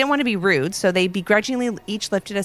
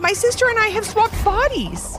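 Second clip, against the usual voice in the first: put-on anxious kid voices; 13 semitones higher than usual. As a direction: spill it out in a panic. Kids voice panicked characters.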